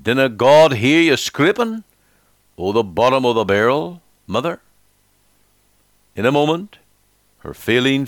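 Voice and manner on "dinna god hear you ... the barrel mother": put-on a light Scottish boy's lilt on the question; Scottish dialect. A narrator's reading. Little boy Robbie comforting his weeping mother, light Scottish dialect. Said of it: "in his quaint Scottish dialect".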